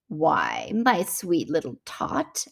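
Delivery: low, slow sweet-talk; lightly voiced Santa telling a soothing lie; fake Santa. A narrator's reading. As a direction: drop low and slow, softly coaxing a child. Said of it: "the fake Santa Claus lied".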